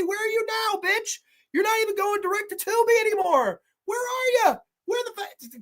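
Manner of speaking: high, mock-shouted search; high shouted teasing, searching the crowd; mocking calling out. Real, not acted. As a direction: call out for a missing actress. Mock search for vanished actress.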